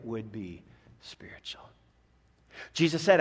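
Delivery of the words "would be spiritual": whisper. Real, not acted. Intimate aside to congregation.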